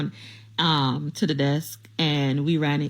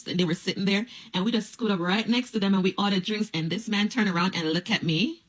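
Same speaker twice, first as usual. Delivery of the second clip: ordinary talk, nothing marked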